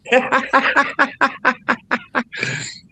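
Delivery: long high cackling laugh; real belly cackle breaking into the chat; wicked laugh. Real, not acted. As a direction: let out a big wicked cackle. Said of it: "You got a wicked laugh".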